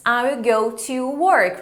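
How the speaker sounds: "I will go to work" spoken slowly, stress on "work"; unhurried teacher model; slow model sentence. Shown as a demonstration. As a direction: model it for students to copy.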